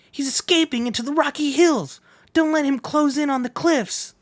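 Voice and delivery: high, excited rider shouting; different voice. Acted. A pursuing rider shouts at the others, high and urgent. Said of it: "I gotta do a different voice now".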